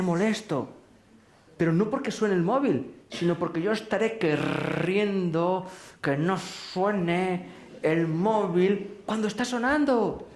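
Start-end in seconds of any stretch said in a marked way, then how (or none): 3.1-10.3 slow, drawn-out emphasis, higher pitched; labouring each word in mock effort; straining insistence. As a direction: stretch the words out as if straining with wanting. Acts out wanting it silent.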